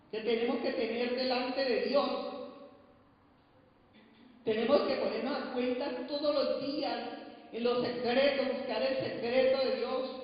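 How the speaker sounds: loud, urgent exhortation; fervent preaching. Acted. Preacher urging Christians to seek God daily, fervent. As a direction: drive the point home to the church.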